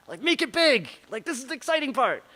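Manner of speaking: high, loud director calling out big instructions; excited director. Acted. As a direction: bark it like an eager film director. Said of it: "just wanna be the director".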